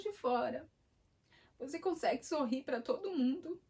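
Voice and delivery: Holy Spirit rebukes the speaker, firmly but lightly voiced; strong inner voice. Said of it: "falou forte".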